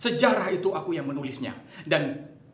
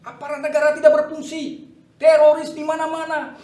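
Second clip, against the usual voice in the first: rising rant; 8 semitones higher, 9 dB louder than usual. Acted. General's rising political rant.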